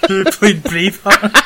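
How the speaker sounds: high, slow, laughing disbelief; laughing echo. Real, not acted. Laughing echo of his co-host's question. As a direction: repeat it back, amused she forgot.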